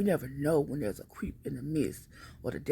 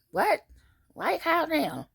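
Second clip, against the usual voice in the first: much higher, louder baffled yelp; stunned man; 5 dB louder, slower than usual. Retold or imitated. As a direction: sound caught completely off guard. Stalker stunned by her yelling.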